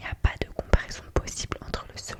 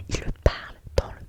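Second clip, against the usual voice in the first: usual speaking voice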